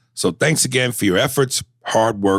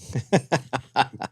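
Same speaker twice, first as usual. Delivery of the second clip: quiet laugh under the breath; soft breathy laugh; 3 semitones higher, 4 dB quieter than usual. Real, not acted. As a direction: chuckle softly at your own joke. Laughs at own punchline.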